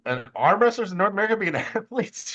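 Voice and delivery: high-pitched sarcastic disbelief; mock incredulity. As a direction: act scandalized at the very idea, pitch climbing. Mocking non-athlete arm wrestlers' disbelief.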